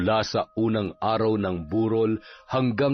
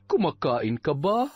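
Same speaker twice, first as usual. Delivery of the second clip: lilting concern, swooping high; solicitous captain; 8 semitones higher than usual. Acted. Captain checking on grieving Aurora, solicitous. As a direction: fuss over the bereaved girl. Captain approaches the bereaved daughter.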